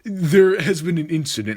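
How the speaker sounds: goofy pretend-official voice to stay calm; dumb calm voice. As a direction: fake composure over the phone. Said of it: "I put on, like, a dumb voice to try to calm myself".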